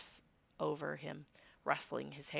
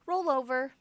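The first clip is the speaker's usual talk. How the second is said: high, louder trick command; playful command; 10 semitones higher, 7 dB louder than usual. Acted. The hero asks Socks to roll over, playfully high. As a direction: sing it out like a dog trick. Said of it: "you say".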